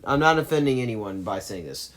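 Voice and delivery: ordinary delivery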